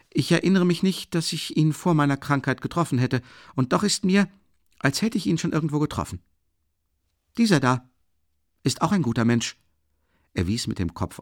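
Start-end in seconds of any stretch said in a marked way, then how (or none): 0.1-9.6 high, animated chatter; unusual liveliness. A narrator's reading. Brother chattering about the men present, unusually lively. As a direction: rattle on eagerly, voice pitched up. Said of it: "mit einer an ihm nicht gewohnten Lebhaftigkeit".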